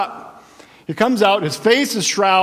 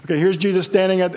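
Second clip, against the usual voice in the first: ordinary delivery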